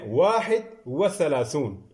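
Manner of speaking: slowly, word by word; teacher dictating slowly; slow dictation. Shown as a demonstration. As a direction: say it for the class to write down. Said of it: "دیکھیں".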